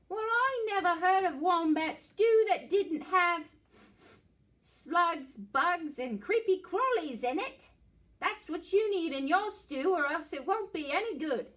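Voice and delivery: echidna voice. Acted. Echidna telling the dingo the stew needs bugs, high and bossy. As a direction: insist like a fussy expert.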